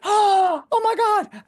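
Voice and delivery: shocked. Retold or imitated. Watching her boyfriend flatten the official, shocked loud high outburst. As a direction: blurt it out stunned. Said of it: "And I went".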